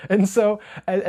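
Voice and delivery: breaks into a high laugh mid-word; laughing at how it turned out. Real, not acted. Laughing as he tells viewers his run turned around.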